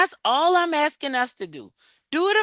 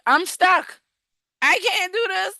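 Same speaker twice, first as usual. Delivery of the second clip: higher, pleading retold Gideon; 9 semitones higher, 3 dB louder than usual. Gideon telling God he is stuck, pleading higher. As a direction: voice Gideon's protest, pitched up and a bit louder.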